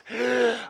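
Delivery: high, loud, desperate gasp; gasping up out of the water; loud gasp for air. Retold or imitated. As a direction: gulp for air like a half-drowned man. Drowning man surfaces.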